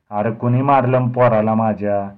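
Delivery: loud roar. Acted. Bhimu arrives and roars at the crowd about his son. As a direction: roar it out like a giant father. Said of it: "मोठ्यांदा ओरडून".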